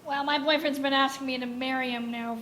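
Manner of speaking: usual speaking voice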